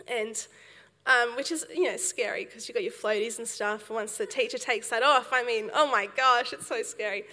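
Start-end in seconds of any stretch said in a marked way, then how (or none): none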